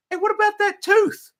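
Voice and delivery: very high mocking jab; mocking squeak. Taunting the lady about her tooth, high mocking squeak. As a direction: poke fun in a squeaky voice. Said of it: "And I was like".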